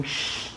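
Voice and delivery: soft hushing shh; gorilla shushing. A narrator's reading. Gorilla shushing the reader, soft shh. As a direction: hush the reader, finger to lips. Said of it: "putting his fingers to his lips and saying".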